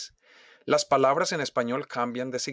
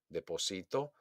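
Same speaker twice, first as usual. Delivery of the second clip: much softer "deposito", weight on SI; stress on SI; 3 semitones lower, 11 dB quieter than usual. Shown as a demonstration. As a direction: say "deposito" softly, leaning on SI. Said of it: "hago un golpe de voz". ("deposito" suave, acentuado en SI)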